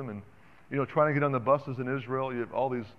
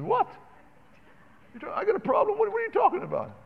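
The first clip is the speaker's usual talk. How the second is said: much higher, incredulous what-are-you-talking-about; indignant surprise. Retold or imitated. Husband to his wife in the car, high incredulous surprise. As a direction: play the wounded, disbelieving husband.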